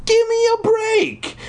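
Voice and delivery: very high, drawn-out squealing protest; hammed-up squeal, stretch every word; crying out. A narrator's reading. Brother protests to his blocking sister, over-the-top high cry. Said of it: "he cried".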